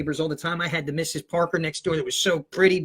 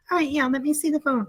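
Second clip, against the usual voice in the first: fake mom voice; 8 semitones higher than usual. Teen invents his mother for the teacher, high faraway voice. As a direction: answer as a distant, put-on mom. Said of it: "The voice just came out".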